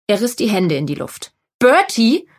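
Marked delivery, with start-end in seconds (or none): 1.6-2.3 lauter Ruf. A narrator's reading. Sue fragt die Männer ab.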